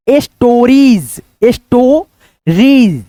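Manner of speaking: "Stories" drawn out slowly as es-to-reez; broken into syllables. Shown as a demonstration. Teacher sounds out stories for learners, slow syllables. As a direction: split "stories" with long vowels and gaps. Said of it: "इसको क्या पढ़ेंगे".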